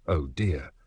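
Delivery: worried. Acted. Brian reacting to news.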